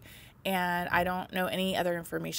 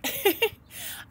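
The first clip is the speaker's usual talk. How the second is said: teary laugh; 12 semitones higher than usual. Real, not acted. Tearful laugh after crying remark.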